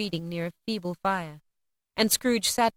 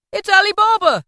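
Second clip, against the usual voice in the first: high, loud cry of delight; overjoyed, high-pitched shout; ecstatic exclamation; 12 semitones higher, 10 dB louder than usual. A narrator's reading. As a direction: burst out in ecstasy. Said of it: "Scrooge exclaimed in ecstasy".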